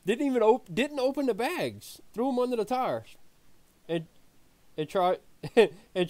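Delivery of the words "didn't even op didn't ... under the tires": amazed disbelief. Retold or imitated. Incredulous retelling of chains.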